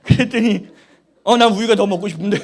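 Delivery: loud, laughing retelling; can barely talk for laughing; laughing while telling the milk story; laughing through it. Real, not acted. Laughing while retelling son exchange.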